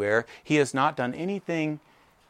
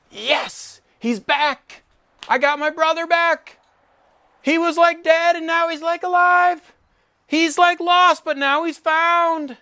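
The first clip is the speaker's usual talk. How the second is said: much higher and louder, bursting with joy; overjoyed brother; 8 dB louder than usual. Acted. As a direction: gush like a thrilled brother who got his sibling back. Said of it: "the response of the older brother should be".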